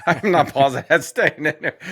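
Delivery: talking through real laughter; laughing. Real, not acted. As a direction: laugh while you say it. Laughing at co-host's joke.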